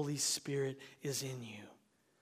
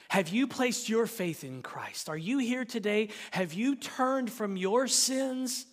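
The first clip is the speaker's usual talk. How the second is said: raised voice building to a loud, high cry; urgent preaching appeal; 6 semitones higher, 7 dB louder than usual. Real, not acted. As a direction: press the listeners with mounting intensity.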